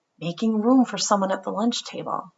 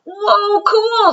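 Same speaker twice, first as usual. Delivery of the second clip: high, loud whoa of wonder; wide-eyed kid excitement; amazed; 13 semitones higher, 7 dB louder than usual. Acted. Emilio seeing the photograph of houses, high and loud amazement.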